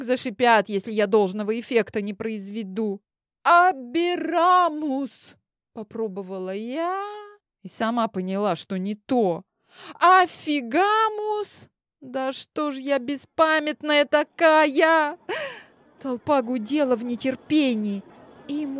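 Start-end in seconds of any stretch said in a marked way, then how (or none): 3.4-5.4 high, slow spell call; spell incantation. Acted. Casting a spell. 10.0-11.7 high, slow spell call; spell incantation. Acted. Casting a spell. 12.0-15.2 high, slow self-scolding; frustrated. Acted. Annoyed at herself.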